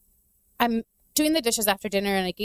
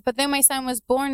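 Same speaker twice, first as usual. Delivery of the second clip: ordinary delivery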